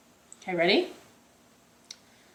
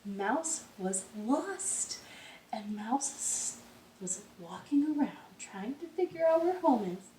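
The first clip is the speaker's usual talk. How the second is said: softer, slow bedtime narration; hushed storytelling; 7 dB quieter than usual. A narrator's reading. Teacher reading a folk tale to the children, hushed.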